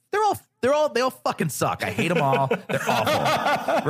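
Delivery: high, laughing rant against pop-ups; riled-up rant. Real, not acted. To the host, ranting about pop-ups in a high laughing voice. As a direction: vent with a laugh in your voice. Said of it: "I'm all angry".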